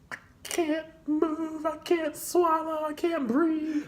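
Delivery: high, slow, strained paralysed voice; barely getting words out, overwhelmed; frozen dread. Retold or imitated. Frozen with nerves.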